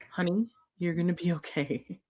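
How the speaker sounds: gentle, hushed-down comforting words; tender reassurance. Acted. Speaking to her past self at the crib, tender reassurance. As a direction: softly comfort your younger self.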